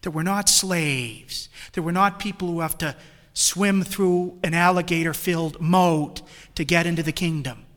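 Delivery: loud, forceful sermon delivery; fervent preaching. Acted. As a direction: preach it to the whole church.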